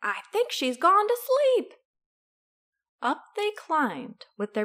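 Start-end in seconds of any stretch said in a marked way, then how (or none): 0.0-1.7 high, loud character voice; high-pitched storybook crook; scheming villain. A narrator's reading. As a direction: pipe up eagerly to your partner. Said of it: "Said Hugh".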